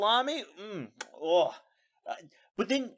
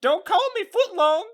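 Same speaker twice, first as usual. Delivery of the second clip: hammed-up cowboy character; 10 semitones higher, 3 dB louder than usual. Introducing the made-up character Jumbo Plumper in a high cowboy voice. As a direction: greet the kids like a goofy cowboy star.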